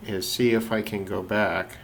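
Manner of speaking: usual speaking voice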